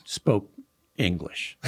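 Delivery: ordinary talk, nothing marked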